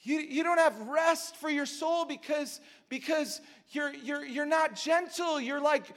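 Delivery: fervent preacher building up; impassioned preaching. Real, not acted. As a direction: press the point hard from the pulpit. Preaching at full force.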